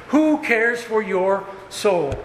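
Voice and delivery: who drawn out high and slow, sinking at the end; slow searching question. Acted. Preacher probing the congregation about their souls, slow and high. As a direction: ask it slowly, letting it land.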